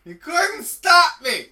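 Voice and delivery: slow, loud, high taunt; taunting shout. Pretend. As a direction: yell it like a gloating villain. Acted taunting quote.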